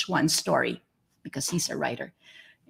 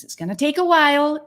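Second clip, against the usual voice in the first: higher, quick and urgent; emphatic quote; 8 semitones higher, 5 dB louder, faster than usual. Retold or imitated. Rereading the Ira Glass quote to fellow artists, higher and quicker. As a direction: push it out like a pep talk. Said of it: "And as Ira Glass said".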